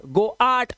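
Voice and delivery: गो आट split in two, "go-aat"; wrong pronunciation. Shown as a demonstration. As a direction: show the mistake, sounding both vowels in गो आट. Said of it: "ऐसा कुछ नहीं पढ़ते".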